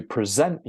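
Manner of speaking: "present" as the verb, "pruh-ZENT"; second-syllable stress. Shown as a demonstration. Teacher giving an example sentence, "present" stressed as a verb. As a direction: schwa first, then stress "ZENT" in "present". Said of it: "the second syllable for the verb".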